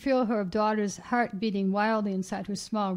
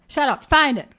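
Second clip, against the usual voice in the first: loud, higher angry order; narrated robber's burst of anger; furious shouting; 5 semitones higher, 8 dB louder than usual. A narrator's reading. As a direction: cut in with a forceful command. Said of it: "He was furious suddenly, shouting".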